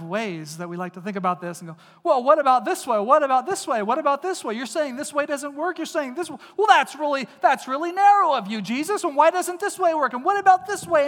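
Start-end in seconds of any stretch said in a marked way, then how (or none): 2.0-11.1 high, loud, rapid objecting voice; a preacher voicing a loud, indignant seeker; mock pleading questioner. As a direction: play the indignant skeptic hammering his point.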